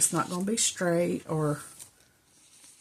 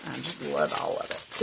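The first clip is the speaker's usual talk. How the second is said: ordinary talk, nothing marked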